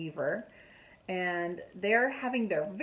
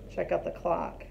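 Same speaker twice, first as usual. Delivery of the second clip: plain reading voice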